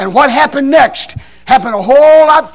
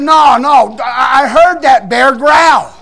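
stretched-out, emphatic denial; retold indignant insistence; insistent protest; 3 dB louder, much slower than usual. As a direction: protest slowly, leaning on the last words. Preacher protests the bear was alive.

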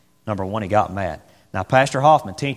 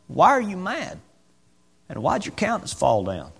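gentle God question; 7 semitones higher, 3 dB quieter than usual. Acted. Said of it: "He just asked him a question".

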